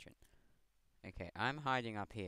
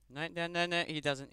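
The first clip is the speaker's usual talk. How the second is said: 1 frantic yell; 9 semitones higher, 6 dB louder than usual. Real, not acted. Gamer reacts to a fight.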